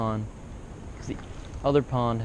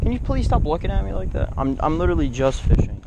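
higher, louder exasperated plea to the staring goose; 6 semitones higher, 6 dB louder than usual. Real, not acted. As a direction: beg the goose to stop staring.

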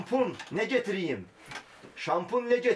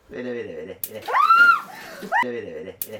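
much lower menacing murmur; low mock threat; 7 semitones lower, 6 dB louder, much faster than usual. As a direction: growl it low like a bully. (alçak tehditkâr ses)

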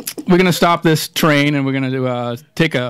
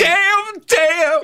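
catchphrase yell; 12 semitones higher than usual. Pretend.

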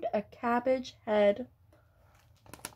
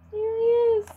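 high, loud joyful "there he is"; excited reveal; 10 semitones higher, 5 dB louder than usual. Acted. Story's end, showing children the butterfly: excited. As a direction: cheer the butterfly's arrival.

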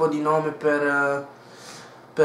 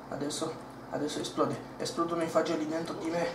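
panicked whisper; 8 dB quieter, faster than usual. Acted. Pessimist panics quietly.

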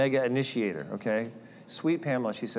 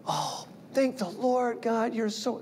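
high, slow, gushing thanks to the Lord; mock grateful gush; 10 semitones higher, slower than usual. Pretending he answered the caller with grateful relief. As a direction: overdo it like a relieved believer. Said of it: "Now, was I".

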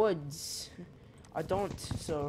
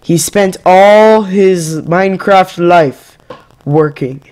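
slow, much louder solemn announcer; mock eulogy; 26 dB louder, slower than usual. Gamer mourning his own Minecraft death, hammed-up eulogy voice. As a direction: intone it like a grand funeral speech.

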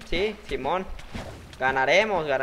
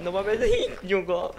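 jungle said through a laugh; laughing at a word; 3 semitones higher than usual. Real, not acted. Gamer amused by the word jungle, laughing. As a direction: chuckle while saying jungle. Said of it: "Me encanta cómo se pronuncia".

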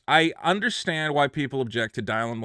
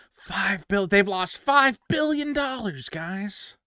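mock alarm, pitched up and slow; sarcastic; 7 semitones higher than usual. Shown as a demonstration. Host mocking the stock panic to viewers, sarcastic mock alarm. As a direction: fake outrage over a red chart, laying it on. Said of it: "Can you sense the sarcasm in my voice".

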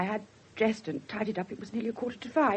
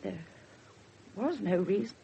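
ordinary talk, nothing marked

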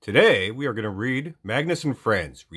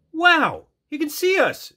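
high, bright burst of wonder; excited exclaim; 4 dB louder, slower than usual. A narrator's reading. Dragonfly Magnus to friends, spotting the baby, excited high voice. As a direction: gasp it out in delight. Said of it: "exclaimed Magnus".

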